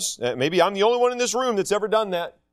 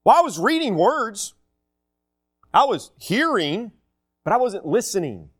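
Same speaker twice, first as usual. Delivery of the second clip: wandering reader; 5 semitones higher than usual. Retold or imitated. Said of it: "your mind wanders and think".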